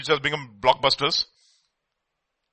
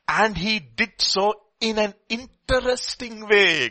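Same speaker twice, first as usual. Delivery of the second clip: drawn out; 6 semitones higher, slower than usual. Acted. Savoring the verse slowly.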